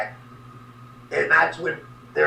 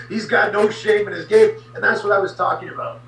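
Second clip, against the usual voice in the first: ordinary talk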